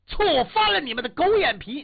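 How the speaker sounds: loud contemptuous sneer; mock bully's jeer; thug's insult. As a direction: spit the insult at him. Thug insults the hero.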